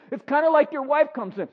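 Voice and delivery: ordinary talk, nothing marked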